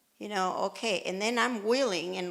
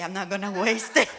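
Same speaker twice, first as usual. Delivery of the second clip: laughing self-talk; 4 dB louder, faster than usual. Retold or imitated. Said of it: "I'm like".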